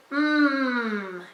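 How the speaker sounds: "mm" as a long steady hum; letter sound. Shown as a demonstration. Teacher saying the sound for the child, "mm" hummed long. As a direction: hold "mm" on closed lips, no vowel after. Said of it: "This sound is".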